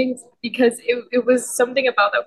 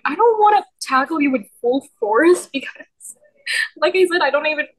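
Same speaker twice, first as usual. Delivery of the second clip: higher, flustered self-quote; higher bashful excuse; flustered reply; 6 semitones higher than usual. Retold or imitated. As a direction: explain yourself all shy. Her own retold reply.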